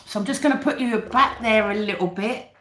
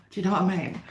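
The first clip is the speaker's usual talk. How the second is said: ordinary talk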